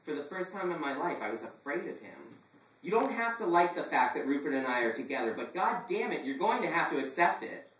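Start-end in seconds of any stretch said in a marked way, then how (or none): 2.8-7.7 loud angry insistence; a touch of furious father. Acted. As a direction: demand he accept it. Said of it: "red with fury".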